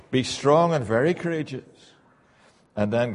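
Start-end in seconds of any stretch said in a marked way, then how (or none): 0.1-1.6 louder proclaimed verse; forceful scripture reading. Acted. Preacher proclaiming God's charge to Joshua, forceful. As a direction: ring it out like a command.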